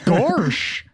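laughing cartoon dog gosh; Goofy voice. Shown as a demonstration. Goofy bits just requested.

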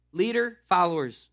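normal talking voice

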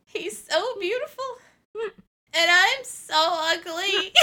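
high, slow, crying whine; pathetic whimper. Retold or imitated. Curled in a ball telling her friend, pathetic crying whine. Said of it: "in this really pathetic voice".

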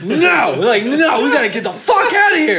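high, panicked refusal, re-enacted; flipping out. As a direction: dig in your heels in a retold panic. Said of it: "I'm flipping out at this point".

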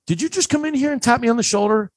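high-pitched call to another room, retold; sustained high, incredulous demand; calling out accusingly. Teen leaning out to question dad, calling out accusingly.

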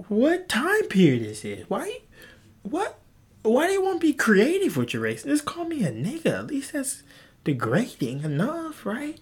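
high, exasperated disbelief; incredulous outrage. Real, not acted. To creative insulters, high incredulous outrage. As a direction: pitch up in baffled outrage.

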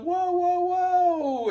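very high, loud overblown cries; mock yelling. Irate caller yelling down the phone, hammed-up shouting. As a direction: shriek it like the caller is losing it. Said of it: "And just starts yelling".

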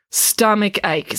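slow, plainly stated answer; matter-of-fact. A narrator's reading. Boy names the side effect, matter-of-fact. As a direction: state it slowly as simple fact. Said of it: "said Derek matter-of-factly".

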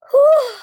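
loud high whistling exhale; hot-food whew. Real, not acted. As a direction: blow out the burn loudly. Recovering from spicy heat.

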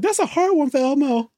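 lightly high Elmo voice; a hint of puppet squeak. Friend retelling Elmo's line, lightly high puppet voice. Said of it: "he's like".

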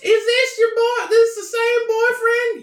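high, loud, sing-song woman voice; man doing a woman's voice. Acted. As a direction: sing it like a gushing mom. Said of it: "you make my dad sound like a girl".

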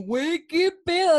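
loud, high, drawn-out mock amazement; asombro actuado. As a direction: act out a stunned viewer's big reaction. Imita reacción del público asombrado. (asombro exagerado, agudo y fuerte)

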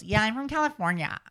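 West Coast accent, pitch lifted high at word ends. Shown as a demonstration. Host demonstrates a traditional West Coast accent. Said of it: "West Coast".

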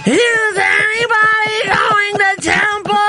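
high, slow, wailing whine; real whiny. Retold or imitated. Friend Stacy in the dream, wailing to the office. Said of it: "Like, real whiny".

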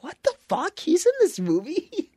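high, stunned what-the; shocked disbelief. Retold or imitated. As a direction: sound baffled and shocked. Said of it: "I was like".

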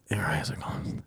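soft unvoiced whisper; hushed conspiratorial whisper; whispered aside. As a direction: breathe it under your voice. Eyes-closed therapy scene retold.

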